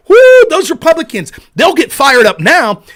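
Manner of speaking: loud, sarcastic whooping cheer; jeering fake hype; mock excitement. Mocking Republicans as tough guys.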